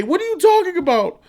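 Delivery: comic high-voiced buddy; high mock friend voice. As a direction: squeak it out like a startled inmate. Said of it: "they said".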